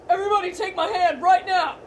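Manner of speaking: loud commanding call over wind; urgent shout. Acted. Urgent order in storm.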